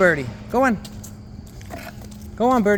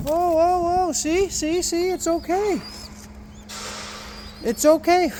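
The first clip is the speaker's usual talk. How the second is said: soothing a scared bird; 10 semitones higher than usual. Real, not acted. Soothing trapped pigeon.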